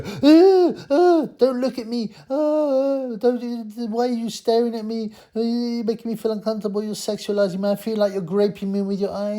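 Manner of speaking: very high, whiny mock outrage; hammed-up mock woman complaining about being stared at; mocking shrill woman. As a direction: parody a woman upset at being looked at. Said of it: "they'll jump up and go".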